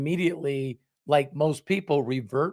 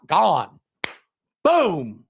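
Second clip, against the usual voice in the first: mock exit; 5 semitones higher, 7 dB louder than usual. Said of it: "viewer clicks away".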